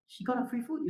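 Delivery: ordinary delivery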